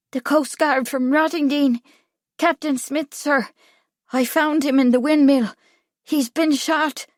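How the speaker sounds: put-on high boy's voice; nervous boy witness. A narrator's reading. Boy telling Kipling of the body, high and urgent. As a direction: report it high, in short phrases. Boy reports the dead man.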